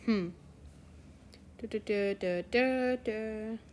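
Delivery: usual speaking voice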